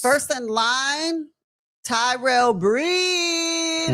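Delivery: slow, stretched, shouted name; ring announcer. Acted. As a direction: call the name like a boxing announcer.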